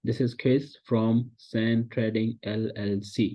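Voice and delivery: very slow, careful American-accented intro; drawn out like a rehearsed sales call; slow practised call opener. Shown as a demonstration. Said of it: "इसी flow में बोलना है". (बहुत धीमे, American लहजे में परिचय)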